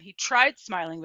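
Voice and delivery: ordinary delivery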